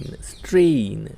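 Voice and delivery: Strain drawn out slowly as strain; teacher slowly spelling out the sound of strain; slow model word. Shown as a demonstration. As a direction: stretch strain out for the learner. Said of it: "એને શું કહેવાય છે?".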